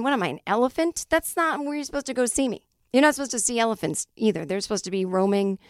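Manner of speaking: ordinary talk, nothing marked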